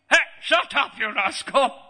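loud, high angry parent's shout; explosive acted scolding. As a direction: yell like a parent silencing a child. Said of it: "how did the parents reward me?".

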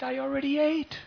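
high, loud, otherworldly reply; the Lord answering a child; gentle deity. Acted. Gopinath tells the boy he already ate, high and ringing.

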